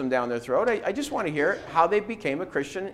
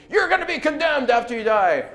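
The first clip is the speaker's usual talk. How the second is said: higher and louder, a hammed-up accusing preacher; mock condemning shout; 9 semitones higher, 7 dB louder than usual. Teacher mimics a harsh accuser for the class, loud mock condemnation. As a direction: bark the verdict like a mock hellfire preacher.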